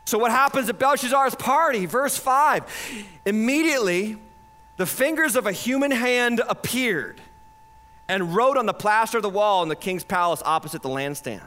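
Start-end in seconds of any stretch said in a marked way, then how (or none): none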